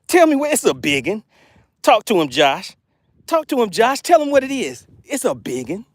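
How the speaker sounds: ordinary talk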